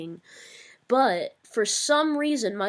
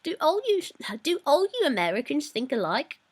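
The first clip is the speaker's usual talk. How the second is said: higher-pitched British accent; more British accent; 6 semitones higher than usual. Shown as a demonstration. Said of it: "There we go, that's more British".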